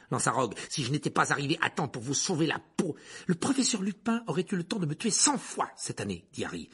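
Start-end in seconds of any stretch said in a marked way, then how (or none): 0.7-6.3 raised, mounting protest; heated argument. Acted. Boy and teacher quarrel at the door, heated. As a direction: argue back higher as it goes.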